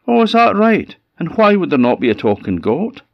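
high, loud, challenging goat voice; indignant talking goat. Acted. Goat answers old woman who doubts it can talk, indignant. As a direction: pipe up high in mock affront.

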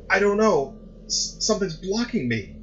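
high, tearful stammer; crying. A narrator's reading. Son crying to his mother, high and tearful. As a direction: break down while explaining. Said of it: "he cried".

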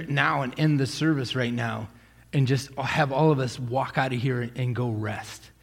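ordinary talk, nothing marked

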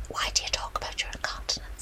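hushed, unvoiced mock whisper; imitated whisper. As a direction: whisper it like a shameful secret. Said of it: "What are you whispering for".

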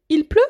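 Il pleut? with pitch rising on pleut; rising question tune. Shown as a demonstration. Teacher showing a spoken question, rising on pleut. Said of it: "mais avec l'intonation de la question".

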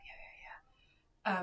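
breathy whispered yeahs; the caller brushing off the pharmacist, whispered; whispered mock reply. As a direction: whisper a dismissive yeah yeah.

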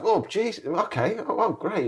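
high, flustered catching up; acted-out dazed delight, pitched well up; retold stunned surprise. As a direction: replay trying to take in big news. It took me a moment to catch up.

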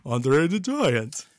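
slow, lightly voiced gentle giant; a hint of a giant, drawn out slowly; Andre the Giant impression. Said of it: "the closest thing I've got to an Andre the Giant impression".

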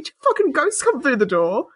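ordinary delivery

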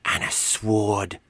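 put-on slow childlike add-on to a wish; little kid savouring the last item; child. A narrator's reading. Child adding to the wish list, slow kid voice. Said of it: "said the child".

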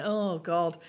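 normal talking voice

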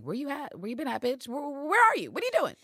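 hammed-up pushy old spy; mock Constantine. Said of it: "And Constantine is like".

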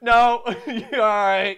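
loud, high comic yelp; hasty mock refusal. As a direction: blurt it very loud, laughing. Retold hasty refusal of the pill.

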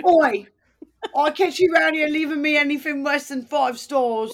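high, loud, hammed-up British accent; bad British accent. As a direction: bark it in a badly faked British accent. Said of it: "whenever I try to do this accent".

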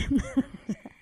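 short real laugh. Real, not acted. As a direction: burst out laughing. Laughing at absurd answer. (rire bref)

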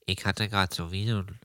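dazed, low and drowsy; just waking, lost and muddled; groggy from anesthesia. Acted. Wakes confused: where am I. (benommen nach der Narkose)

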